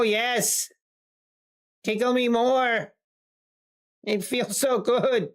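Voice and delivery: teasing, playfully exaggerated swooning; mock pleasure. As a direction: pretend the market's tickle feels great. Mock pleasure at being tickled.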